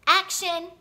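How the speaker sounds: loud shout of Action; director's yell. A narrator's reading. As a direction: bark the cue across the set. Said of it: "the director yelled".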